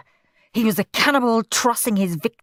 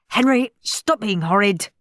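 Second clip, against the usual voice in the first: usual speaking voice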